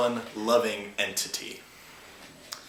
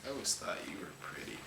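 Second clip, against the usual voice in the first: low quiet voice; 5 semitones lower, 12 dB quieter, faster than usual. Acted. Character aside, low quick.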